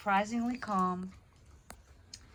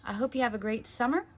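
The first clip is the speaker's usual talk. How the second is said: friendly sign-off; 4 semitones higher, 4 dB louder than usual. Real, not acted. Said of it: "reader signs off to listeners".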